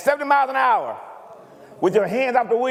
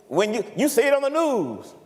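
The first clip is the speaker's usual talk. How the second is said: ordinary talk, nothing marked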